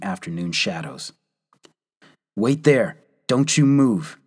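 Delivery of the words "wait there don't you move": louder and higher warning; sentry warning the stranger, shouted. A narrator's reading. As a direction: bark the warning to freeze. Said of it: "warned the unseen voice".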